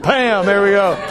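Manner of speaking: high, punchy congratulations; delighted praise. Real, not acted. Teacher praising former Greek student for the right answer, delighted.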